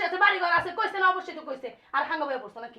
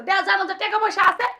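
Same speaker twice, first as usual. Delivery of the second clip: ordinary talk, nothing marked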